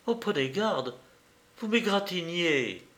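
a startled "ouch", kept light; sudden exclamation. A narrator's reading. Javotte to Tristan as his card scratches her, mildly startled exclamation. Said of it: "Lorsqu'elle s'écria tout à coup".

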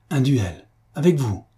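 high, falling cries of disbelief; incredulous outcry; exclaiming. A narrator's reading. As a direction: exclaim it in two astonished bursts. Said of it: "s'écria monsieur de Mornan".

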